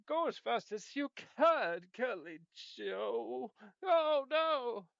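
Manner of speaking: high, loud hammed-up panic; silly clown. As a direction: shriek at the coming train. Train coming, panic.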